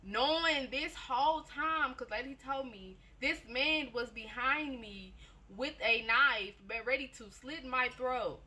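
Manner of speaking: high, slow, spaced out; telling the knife reveal, slowed right down; dramatic slow build. Acted. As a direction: drag it for suspense.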